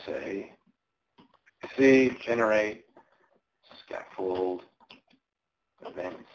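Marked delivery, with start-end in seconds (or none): none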